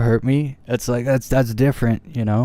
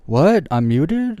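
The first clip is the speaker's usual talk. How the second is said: mock surprised, pitched up; fake surprise; 6 semitones higher, much slower than usual. Streamer pretending to be confused by a troll, mock surprise. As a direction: play dumb, as if shocked to be muted. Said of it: "And then I'm all like".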